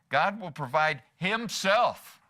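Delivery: himself drawn out slowly and punched; slow, weighty emphasis on himself; stress on HIMSELF. Shown as a demonstration. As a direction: lean hard and long on the word himself. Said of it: "like to put emphasis on different words".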